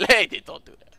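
loud mock Russian voice; Russian accent. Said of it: "He's Russian".

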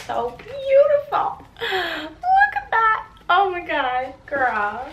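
high, loud, slow gasp of awe; awed delight. Real, not acted. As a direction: stretch it out, dazzled by the shoes. First sight of shoes.

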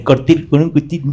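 laughing through the repeat. Real, not acted. Repeating the tense line to the audience, laughing. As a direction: crack up on it.

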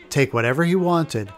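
ordinary talk, nothing marked

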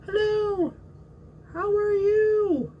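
high squeaky puppet voice; sock puppet voice. Pretend. Puppet greeting the viewers, high squeaky voice.

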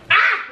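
high sharp yelp of pain; real startled ouch. Real, not acted. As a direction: yelp as the hot pan burns. Burned by hot pan.

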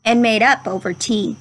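gentle, measured storybook read-aloud; unhurried classroom story reading voice; reading to children. Acted. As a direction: read it like a picture book to a class. Teacher reads picture book aloud.

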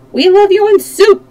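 high, loud chorus yell. A narrator's reading. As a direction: yell it as a gleeful mob. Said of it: "they yelled".

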